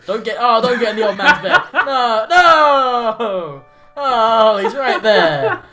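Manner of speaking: loud laughing protest. Real, not acted. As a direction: laugh out a frantic no. Laughing panic at old man bed.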